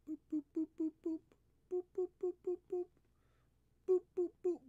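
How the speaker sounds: high squeaky booping, playful; sing-song high boops to tease the cat. Real, not acted. Owner booping his cat's nose in squeaky baby talk.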